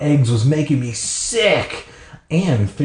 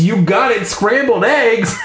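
excited; 9 semitones higher than usual. Acted. Excited reveal of guess.

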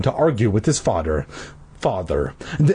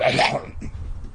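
laughing; 5 dB quieter than usual. Real, not acted. Reader cracks up mid-sentence.

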